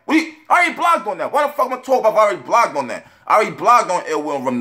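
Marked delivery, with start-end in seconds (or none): 0.1-2.2 much higher, exasperated repeat; exasperated insistence. Real, not acted. Blogger rebutting a rapper's complaint, exasperated. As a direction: say it higher, fed up at repeating yourself.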